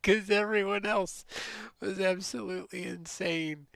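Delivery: high, loud exclamation after a laugh; exasperated exclaiming. Real, not acted. Telling how crazy other video chatters were, exasperated exclaiming. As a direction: cry it out, high and amused.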